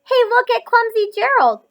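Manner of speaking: high, loud mocking jeer; loud mocking sneer. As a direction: taunt him like a playground bully. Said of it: "the animals all sneered".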